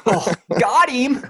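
high, loud and drawn out slowly; teasing gotcha. Real, not acted. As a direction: savor the burn, stretch it high. Teasing a friend's weak answer.

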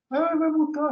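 high, loud whiny child's plea, mock tearful; imitating crying child. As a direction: play the little girl close to tears over the fine. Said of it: "Aí a Laura começou a chorar".

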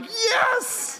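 very high, squeezed cheer; mock-triumphant high-pitched yes; gleeful celebration. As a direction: squeal it like a kid celebrating in secret. Boy secretly celebrates escaping punishment.

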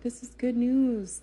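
upbeat encouragement. Retold or imitated. Midwife cheers up her frightened patient, loud and excited. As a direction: sell the good news with big energy.

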